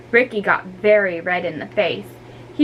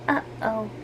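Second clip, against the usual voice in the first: much softer worried uh-oh; quiet uh-oh; 8 dB quieter than usual. Acted. Susan spots her mother, quiet worried. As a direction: breathe it out caught.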